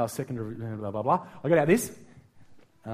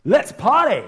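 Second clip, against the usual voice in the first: higher, louder whoop of excitement; party shout; 8 semitones higher, 8 dB louder, slower than usual. Pretend. Jesus imagined greeting his friends with an exuberant shout. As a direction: throw it out like a party invitation. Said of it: "the very first word he said when he saw his friends was".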